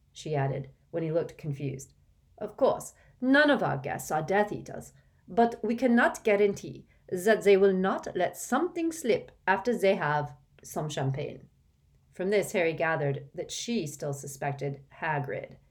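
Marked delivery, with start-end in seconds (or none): 2.4-11.5 put-on a touch of French accent. Fleur warns about guests and champagne, French accent. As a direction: Fleur explains politely in French lilt.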